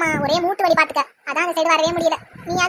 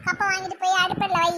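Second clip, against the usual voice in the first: usual speaking voice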